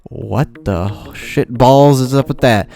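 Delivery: slow, drawn-out voice of disbelief, changed timbre; a dragged-out disbelieving quote; stunned disbelief. Retold or imitated. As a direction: spell out each word in stunned outrage. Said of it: "And I'm just like".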